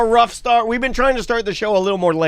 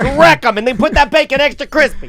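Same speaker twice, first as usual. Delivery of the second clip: diner cook calling orders; 6 semitones higher than usual. Retold or imitated.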